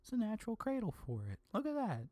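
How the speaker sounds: high, soft, pleased little voice; mock-sweet high murmur to himself; imitated cooing delight. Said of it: "and I'm like".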